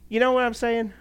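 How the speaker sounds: laughing check-in with congregation. Real, not acted. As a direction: chuckle through it. Laughs during aside.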